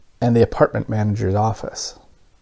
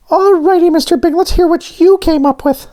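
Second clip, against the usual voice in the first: squeaky high cartoon voice; bright little character voice, pitched way up; high donut voice; 4 dB louder than usual. A narrator's reading. Voices Arnie.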